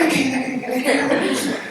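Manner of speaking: high, mock-panicked child; scared little girl. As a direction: squeal it like a girl losing her nerve. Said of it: "There was one particular daughter".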